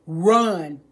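normal talking voice

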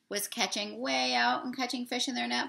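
ordinary delivery

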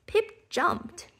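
plain reading voice

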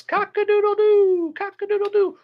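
high crowing rooster call. Shown as a demonstration. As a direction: crow it out like a rooster. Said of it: "so like this".

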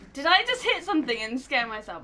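ordinary talk

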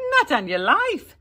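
high, loud refusal; defiant. A narrator's reading. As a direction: refuse him flatly. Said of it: "Jamie said".

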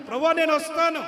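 loud, high cry of ప్రభువా, నేను వస్తాను; Peter's eager cry. Acted. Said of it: "వస్తా అన్నప్పుడు".

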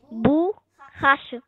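Borracha stretched out syllable by syllable, bu-rra-cha; slow syllable demo. Shown as a demonstration. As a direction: draw Borracha out slowly. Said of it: "Tiramos o bu e fica racha".

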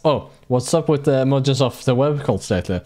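ordinary talk, nothing marked

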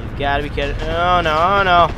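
ordinary talk, nothing marked

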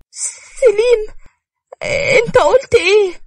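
very high, slow broken cry; stunned disbelief. Acted. Nour, just divorced, asks Selim in shock.

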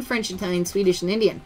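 usual speaking voice

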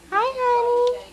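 high cooing greeting. Real, not acted. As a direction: coo it up high to her. Cooing at baby.

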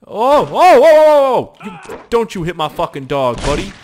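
loud protective shouting; alarmed yell. Real, not acted. Gamer protecting his dog, yelling at the attacker.

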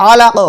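আলাক with an echoing final q; drilled আলাক, short bounce on q; qalqalah on stop. Shown as a demonstration. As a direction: pop the q as you stop on আলাক. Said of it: "অক্ফ করিলে".

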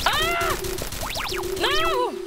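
high-pitched panicked cry; sudden squeal of alarm; alarmed yelp. Real, not acted. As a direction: yelp as if suddenly under fire. Suddenly under fire in game.

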